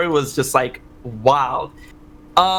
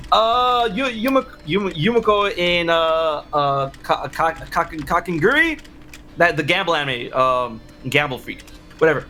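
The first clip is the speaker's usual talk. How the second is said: normal talking voice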